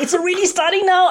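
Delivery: high, worked-up mock scolding; retold yelling. Mother sees her daughter undervaluing herself already, retold yelling. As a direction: act out losing it with your daughter. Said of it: "I start yelling at her".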